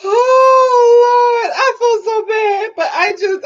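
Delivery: high, drawn-out cry of amused guilt; loud "Oh" easing into a high apology; guilty wail. Real, not acted. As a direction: wail it while recovering from laughter. Guilty wail after mocking the accent.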